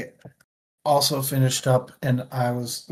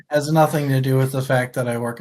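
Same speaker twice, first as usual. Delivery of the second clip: laughing; 7 dB louder than usual. Real, not acted. Joking about his physical job.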